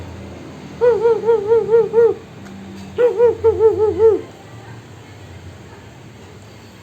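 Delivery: very high squeaky monkey tsz-tsz; monkey chatter. A narrator's reading. Monkeys mocking the peddler, very high chattering. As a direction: chatter back like cheeky monkeys. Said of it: "at him and said".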